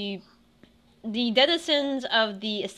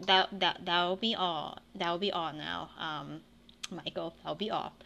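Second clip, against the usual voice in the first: dismissive elf captain; 5 semitones lower, 7 dB quieter than usual. Acted. Elf captain brushing off the party, low and soft. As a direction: lower and softer, curt dismissal. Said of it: "and the woman says".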